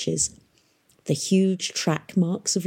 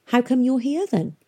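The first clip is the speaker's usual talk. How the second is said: higher-pitched mocking question; 8 semitones higher than usual. A narrator's reading. Girl taunts the weeping boy, mocking. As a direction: challenge him with a touch of mockery. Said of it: "mocking him".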